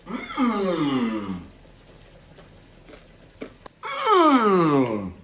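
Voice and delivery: loud, high savoring hums. Real, not acted. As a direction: hum with pleasure at the taste. Tasting, loud high mm sounds.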